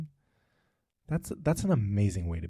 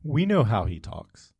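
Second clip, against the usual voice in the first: mock emphatic; 3 semitones higher, 9 dB louder, slower than usual. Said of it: "they were like".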